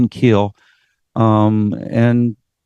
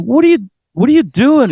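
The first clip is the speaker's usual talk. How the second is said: high, loud, startled question; bewildered outburst; shocked question; 11 semitones higher, 4 dB louder than usual. Retold or imitated. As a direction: react in disbelief, pitched way up. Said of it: "I was like".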